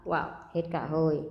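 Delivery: ordinary talk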